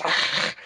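rasped, unvoiced outcry imitating a character; voiceless mock gasp. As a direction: let out a breathy mock screech. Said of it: "and then he goes".

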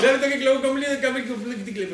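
high, breaking up with giggles; tongue twister through tickle laughter; being tickled. Real, not acted. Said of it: "te concentres en que tenés cosquillas". (con cosquillas, entre risas)

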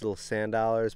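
ordinary talk, nothing marked

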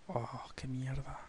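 whispered curse, slow; cursing under breath. Real, not acted. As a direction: hiss a curse to yourself. Missed penalty, whispered curse.

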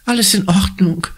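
put-on high, shaken girl's voice, lightly stammered; stammering. Conny stammers to the worried woman. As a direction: get the words out while still shaken. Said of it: "stammelt Conny".

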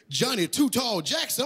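ordinary talk

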